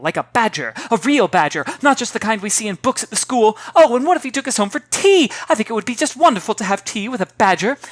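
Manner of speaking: put-on a girl carried away by her daydream; excited babbling girl. Girl babbling about badger.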